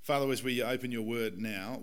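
ordinary talk, nothing marked

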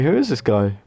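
ordinary talk, nothing marked

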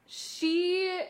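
ordinary talk

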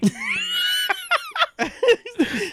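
high shrieking laughter. Real, not acted. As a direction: scream with laughter. Laughing shout.

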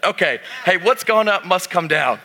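usual speaking voice

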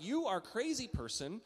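high, exasperated hammed-up complaint; mock indignation. A pastor mocking his own road rage, high pitched. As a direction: rant at the crazy driver. Retelling his reaction to the driver.

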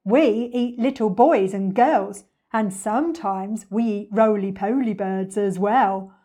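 slow, loud villain threat; greedy storybook villain; menacing crocodile. Acted. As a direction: gloat slowly over the menu.

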